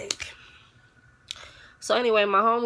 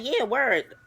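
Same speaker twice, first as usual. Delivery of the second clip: high, light imitation of a chirpy girl; bird-like voice; 6 semitones higher than usual. Imitating the friend's high voice for the listeners. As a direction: mimic her high chirpy way of talking. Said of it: "I'm doing that voice 'cause that's how she sound".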